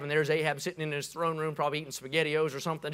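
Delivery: ordinary talk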